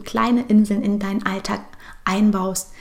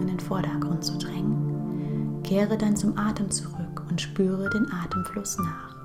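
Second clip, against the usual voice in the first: guided meditation; 6 dB quieter than usual. Acted. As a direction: guide her gently into calm, breath by breath.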